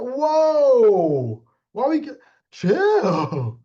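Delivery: loud high whoa, then a very slow chill; mock startled. Told his mama was insulted, mock startled. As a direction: back off in mock shock. Acting out a heated argument.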